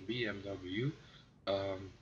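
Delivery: normal talking voice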